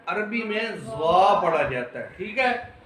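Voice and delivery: normal talking voice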